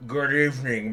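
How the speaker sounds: a smug rich man purring at the secretary; smug tycoon voice. Acted. Tusk voiced in game.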